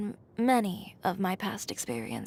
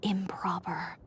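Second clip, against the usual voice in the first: whispered; 4 dB quieter, slower than usual. Retold or imitated. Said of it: "all he said was".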